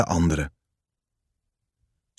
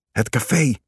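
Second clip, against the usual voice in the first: a raised, higher call of alarm; 4 semitones higher than usual. Acted. Kees calls to Sois amid falling bombs, a raised call. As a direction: call it out over the noise, a touch lifted. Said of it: "riep Kees".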